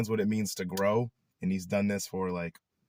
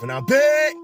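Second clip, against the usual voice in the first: jokey big comeback shout, much higher and louder; mock hype; 10 dB louder than usual. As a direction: announce your return like a fake hype host. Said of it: "Nah, I'm playing".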